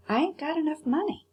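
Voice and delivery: put-on young boy admits he has no money; nervous boy. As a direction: blurt it out anxiously.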